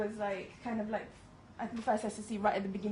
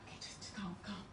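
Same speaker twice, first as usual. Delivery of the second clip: hushed inner voice telling yourself to calm down; soft, muttered self-talk; under the breath, steadying yourself; 11 dB quieter than usual. Retold or imitated. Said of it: "in my head going".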